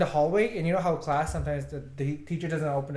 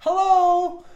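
cheery greeting; 9 dB louder than usual. Retold or imitated. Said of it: "greeting new gym clients".

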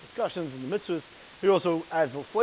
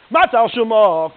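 "Matzah, Al Shuma" stretched out, much louder, as a question; drawn-out loud question; 4 semitones higher, 13 dB louder, slower than usual. Acted. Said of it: "question mark".

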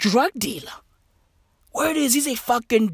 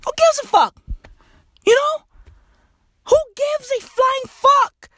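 a much higher squeaky retake; high mock voice. Shown as a demonstration. A comic mocking his own line in a high squeak.

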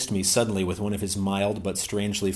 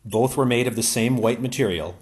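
plain reading voice

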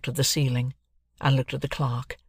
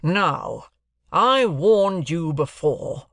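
slow, heavily drawn-out angry warning; irate; 4 semitones higher, 4 dB louder, much slower than usual. Acted. Jaggers warns his terrified client, suddenly most irate. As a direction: warn with rising indignation. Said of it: "suddenly became most irate".

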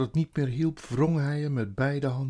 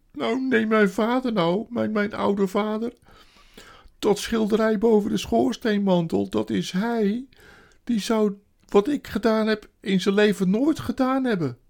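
high, loud self-reproach; woeful creature crying out; tearful wailing faun; 10 semitones higher, 10 dB louder than usual. A narrator's reading. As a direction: bewail your own wickedness. Weeping faun confesses in story.

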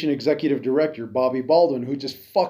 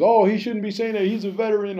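higher, sing-song mocking voice; mock outraged public; 6 semitones higher than usual. Mocking how the public would react to him, in a higher mock voice. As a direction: parody the scandalized crowd, pitched up. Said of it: "fucking everybody be up in arms".

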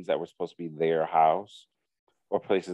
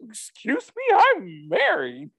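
high, drawn-out retort through laughter; laughing, stretched-out mock offense; mock indignation; 4 dB louder, slower than usual. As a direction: imitate an affronted sister while cracking up. Sister's retort, told through laughter.

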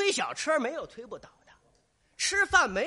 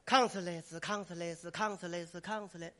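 low, even gong pattern by mouth; imitated slow percussion; 5 semitones lower, 5 dB quieter than usual. Shown as a demonstration. Drummer following the actor's cue, low mouthed percussion. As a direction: chant the drum pattern, getting softer. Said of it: "怎么叫的就怎么打".

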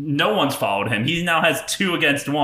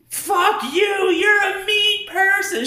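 high-pitched imitation of an outraged drunk girl; mock angry shout; slower than usual. Drunk girl lashing out at the man recording her, mock shouting. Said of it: "she is not thrilled with me".

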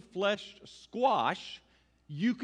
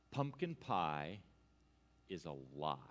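mock-solemn reveal; 12 semitones lower, 8 dB quieter than usual. Preacher revealing the pie secret to congregation, mock-solemn hush. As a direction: drop the grave truth in a hush, pausing midway.

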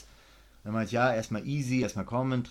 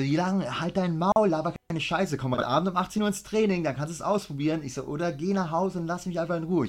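furious; 6 semitones higher than usual. Retold or imitated. Coach retelling how he blew up at the student. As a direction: tear into him, fully fired up. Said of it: "Ich war sofort auf hundertachtzig".

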